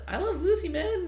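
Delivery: high, gushing fan voice; excited squeal. Real, not acted. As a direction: squeal it out with glee. Gushing over favorite show.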